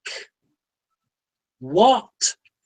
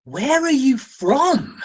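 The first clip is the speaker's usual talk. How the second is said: higher, drawn-out baffled question; exasperated bafflement; 7 semitones higher, slower than usual. Retold or imitated. Reviewer to the absent actor, exasperated and baffled. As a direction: demand it slowly, pitch raised in disbelief.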